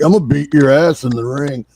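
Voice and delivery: loud mock wrestler threat; jobber voice. Co-host mocking lazy promo makers, loud jobber voice. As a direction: put on a big, blustering jobber voice. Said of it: "that's my go-to jobber voice".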